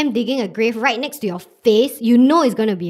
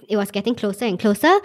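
ordinary talk

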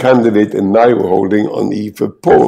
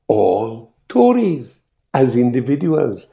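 soft start; 2 semitones higher, 5 dB quieter, slower than usual. Retold or imitated. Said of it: "started almost at a whisper".

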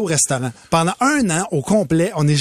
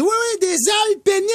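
put-on excited child; 12 semitones higher than usual. Daughter explains to her dad excitedly. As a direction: announce it like a thrilled kid. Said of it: "Elle dit".

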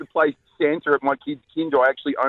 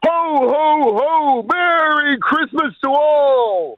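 booming jolly Santa laugh; big slow ho ho ho; 12 semitones higher, 4 dB louder, much slower than usual. Acted. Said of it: "your audition to be Santa".

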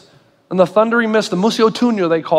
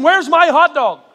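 higher, laughing mock outrage; mock indignant demand; 6 semitones higher than usual. Preacher ending his hot dog rant, laughing mock anger. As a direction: whine for the missing hot dog, hammed up. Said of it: "that's what I was feeling".

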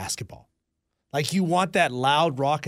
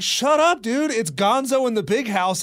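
much higher, louder shut-up outburst; fed-up fan shouting back; exasperated yell; 7 semitones higher, 4 dB louder than usual. Retold or imitated. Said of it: "I was like".

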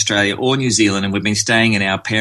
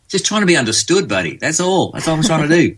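mock defensive; 7 semitones higher than usual. Defending himself.

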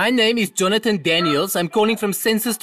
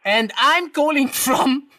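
to someone hard of hearing; 4 semitones higher, slower than usual. Acted. Slowing down for deaf listener.

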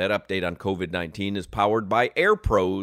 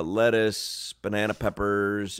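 usual speaking voice

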